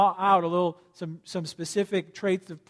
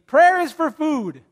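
high, slow third-grader voice; child voice; 7 semitones higher, 7 dB louder, slower than usual. Retold or imitated. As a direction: say it like a proud little kid. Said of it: "He once said to me".

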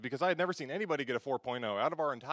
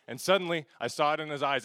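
ordinary talk, nothing marked

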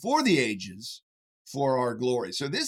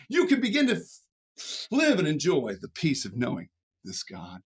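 ordinary talk, nothing marked

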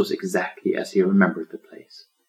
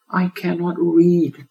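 high, slow sighing little voice; 11 semitones higher, 4 dB louder, slower than usual. A narrator's reading. Turtle admitting to lion he cannot read, sighing. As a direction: let it out with a sigh. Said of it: "the tiny turtle sighed".

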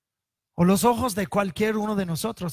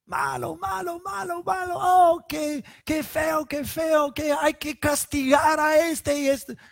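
high, put-on voice of feigned outrage; preacher mocking the wife's outcry; mocking outraged wife; 8 semitones higher than usual. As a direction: parody her fake indignation. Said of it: "levantando su voz en alto".

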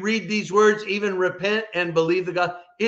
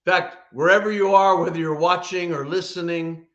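normal talking voice

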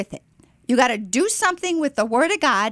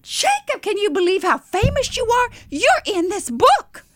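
high, amazed, emphatic; enthusiastic; 10 semitones higher, 3 dB louder than usual. Retold or imitated. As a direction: break astounding news, voice soaring. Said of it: "with that enthusiasm".

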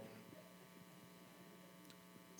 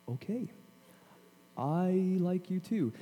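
higher, slow and coy; imitated coy girlfriend; 4 semitones higher, 4 dB quieter, slower than usual. Girl answering his twenty-minute confession, coyly accepting. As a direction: give in gently, drawing it out. Retold girl's soft answer.

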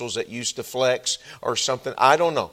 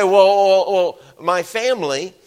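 hesitant churchgoer; 8 semitones higher, 10 dB louder than usual. Retold or imitated. Preacher voicing a listener's excuse, loud and hesitant. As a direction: stall with a mock well, well, well. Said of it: "You say".

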